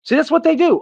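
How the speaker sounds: usual speaking voice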